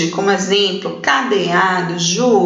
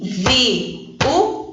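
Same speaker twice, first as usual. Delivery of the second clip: very slow "viúva" split as vi-ú-va; syllable by syllable; 2 semitones higher than usual. Shown as a demonstration. Teacher showing separated vowels to pupils, "viúva" slowly in three syllables. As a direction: pause after each syllable of "viúva". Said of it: "Vamos separar?".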